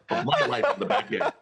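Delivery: high, laughing jokey complaint; high laughing jab at the judge; laughing punchline. Retold or imitated. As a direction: tease him through a laugh.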